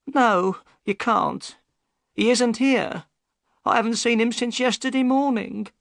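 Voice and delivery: high-pitched upset woman; high female character voice, on edge; Missus Smith, upset. A narrator's reading. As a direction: voice a troubled wife, pitched very high.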